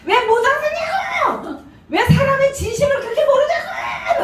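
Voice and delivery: very high-pitched mock wail; frantic outburst. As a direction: imitate a chairman crying out in exasperation. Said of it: "그러면서 난리를 치더라고요". (난리 치며 절규하는 흉내)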